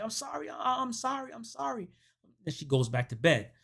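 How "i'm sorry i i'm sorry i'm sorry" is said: high, soft repeated apologies; small high-pitched sorry; mock flustered apology. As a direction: imitate her apologizing meekly. Woman apologizes after waking him.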